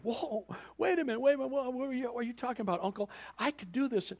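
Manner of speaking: high, rushed mock panic; freaked out niece. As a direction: protest in a flustered rush. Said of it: "She's a little freaked out over this deal".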